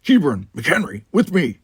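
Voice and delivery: loud, high barked command; barked orders. Acted. Worf barking orders at the cadets, loud. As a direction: bark it like an officer. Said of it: "he was barking orders".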